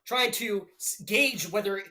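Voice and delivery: live fight calls, rising with the action; play-by-play commentary. Acted. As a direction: narrate the round like a ringside caller.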